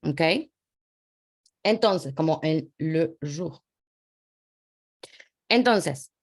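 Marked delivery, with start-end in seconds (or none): 2.8-3.6 "le jour" with a schwa in "le", slow; French muted E. Shown as a demonstration. Teacher repeating the example for students, French muted E. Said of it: "no es la E real".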